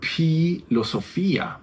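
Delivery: filosofía with a breathy aspirated p, ph-ilosofía; enseñar filosofía con p aspirada; aspirated Attic ph. Shown as a demonstration. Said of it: "Entonces vamos a decir".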